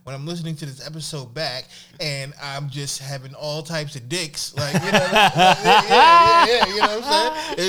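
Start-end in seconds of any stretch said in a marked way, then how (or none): 4.5-7.7 loud high laughing exclamations; excited laughing. Real, not acted. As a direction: shout it through laughter. Laughing, excited defending the joke.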